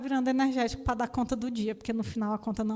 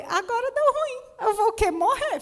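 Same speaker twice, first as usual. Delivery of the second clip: panicked; 10 semitones higher, 4 dB louder than usual. Retold or imitated. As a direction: sound like you think you are about to die. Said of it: "Porque eu falei".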